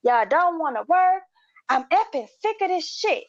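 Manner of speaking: high mock outburst; imitated snapping. Customer snapping at the staff, mock angry. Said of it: "he snapped".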